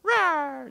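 high, puny mock roar; a tiny cub trying to sound fierce; hammed-up squeaky roar; little cub roar. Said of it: "and he goes".

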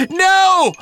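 loud, high ticklish cry; high cried protest. A narrator's reading. Professor begs off his tickling robot, crying out. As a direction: cry out through a tickling fit. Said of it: "cried Professor Drolling".

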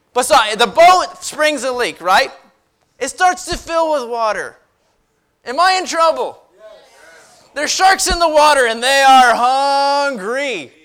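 loud, high, punchy storytelling sermon; animated preaching. Real, not acted. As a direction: work up the congregation with rising urgency.

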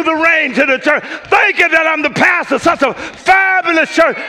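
from steady to high, shouted praise; preaching build. Acted. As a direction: climb into a shouted thanksgiving.

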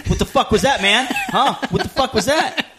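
hammed-up furious bouncer, pitched high with laughter; angry bouncer. Bouncer confronting George, imitated as a furious yell. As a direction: confront him like you're about to throw a punch. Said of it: "angry as shit".

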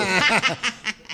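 evil laugh. Acted. Villain laughs after killing folks.

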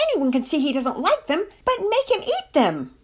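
high, loud outburst, slowing at the end; exasperated yell. A narrator's reading. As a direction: climb in pitch and hammer the final words. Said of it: "Penelope wanted to yell".

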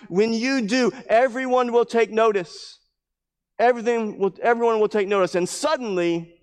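high, driving sermon delivery; forceful preaching. Real, not acted. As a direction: press each point home to the congregation.

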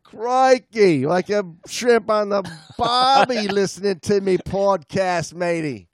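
playful put-on Australian voice; mock Australian. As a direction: lay the Australian on thick. Keeps up Australian voice.

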